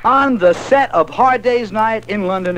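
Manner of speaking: high-pitched, excited event announcement; hyped radio announcer. Acted. Radio host announcing the Beatles at Wembley, hyped announcer. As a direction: sell it like a thrilled DJ on location. Hyped reports from stadium and set.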